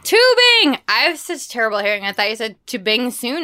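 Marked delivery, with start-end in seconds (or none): 0.0-0.8 high, loud burst of delight; excited. Real, not acted. Host repeating her co-host's plans, loud and delighted. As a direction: shout the word out in glee.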